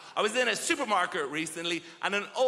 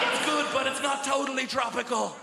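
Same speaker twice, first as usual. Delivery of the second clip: mock tasting verdict; 6 semitones higher than usual. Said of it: "you're like".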